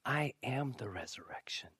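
hushed, deliberate, intimate declaration; Jesus, slowing down. Retold or imitated. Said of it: "slows down the conversation".

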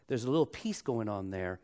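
ordinary talk

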